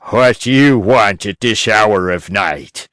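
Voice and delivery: put-on gruff old man calling down, annoyed; a grumpy old man woken at night; angry old man. A narrator's reading. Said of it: "He called down angrily".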